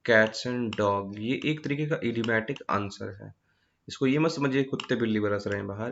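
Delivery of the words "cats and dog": slow example phrase. Shown as a demonstration. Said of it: "आर ए आई एन आई एन जी".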